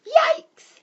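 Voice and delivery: very high, loud yelp of alarm; startled shout. A narrator's reading. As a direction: yelp as if suddenly frightened. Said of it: "shouted Mandy".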